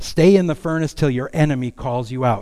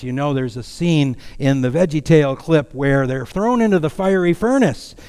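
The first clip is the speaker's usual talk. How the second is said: usual speaking voice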